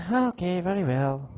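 little squirrel giving in. Acted. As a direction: accept it in a small high voice. Squirrel accepts rejection.

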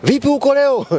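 high-pitched mocking phone-chatter voice; put-on high voice, poking fun; mock WhatsApp spokesman. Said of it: "Msemaji huwezi kufanya kazi kwa, kwa WhatsApp".

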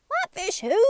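puzzled mayor. Acted. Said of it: "said the mayor".

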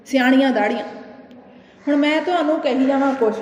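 ordinary talk, nothing marked

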